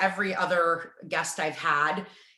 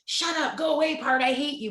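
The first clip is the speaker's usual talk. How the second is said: higher-pitched, snappish mock rejection of your own part; hammed-up dismissal aimed inward, higher; mock angry outburst; 8 semitones higher than usual. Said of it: "intuitive to be like".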